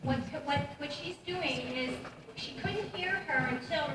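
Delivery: ordinary talk, nothing marked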